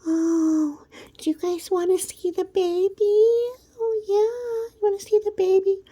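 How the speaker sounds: high cooing mock of a proud new mom; squealing fake mother voice; baby-talk mock. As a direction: coo it out like a doting parent showing off a newborn. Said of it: "She was like".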